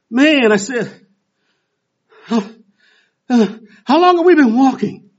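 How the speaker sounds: high, loud, breathless gasping; huffing and puffing. Retold or imitated. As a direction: wheeze it out like you're dying on a walk. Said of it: "I'm huffing and puffing".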